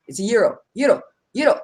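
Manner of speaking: "gyro" repeated as YEE-roh; correct pronunciation. Shown as a demonstration. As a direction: drill "gyro" with a silent G. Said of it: "The G is silent".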